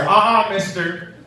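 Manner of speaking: dad ready to punish, dead serious; stern dad. Retold or imitated. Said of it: "and I hear".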